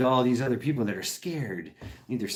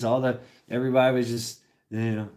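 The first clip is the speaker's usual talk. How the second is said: ordinary talk, nothing marked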